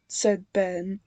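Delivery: usual speaking voice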